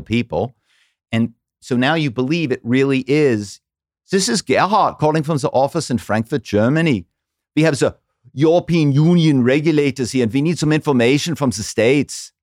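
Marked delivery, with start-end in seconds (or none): 4.1-12.3 put-on thick German accent, higher and louder. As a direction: play a German office caller with a heavy German accent. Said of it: "putting on a German accent".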